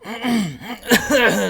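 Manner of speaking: rough throat clearing with a chuckle. Real, not acted. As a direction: clear the throat, sick with a cold. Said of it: "Sorry, I'm coughing again".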